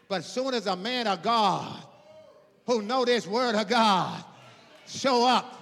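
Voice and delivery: loud sermon climbing to a shout; preaching at full force. Real, not acted. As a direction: build phrase by phrase to a shouted climax.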